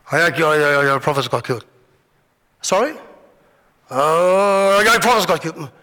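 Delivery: lightly voiced king shrugging off bad news; offhand husband. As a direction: mumble it like it's no big deal. Ahab casually telling wife in bed.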